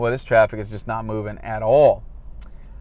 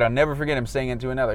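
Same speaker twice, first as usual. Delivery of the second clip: ordinary talk, nothing marked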